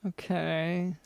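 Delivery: doubtful, flat okay, dropping off; a guest host who doesn't buy it, skeptical okay. Acted. Host doubting the guest's story, dry skeptical okay. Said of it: "Doctor London still seems skeptical".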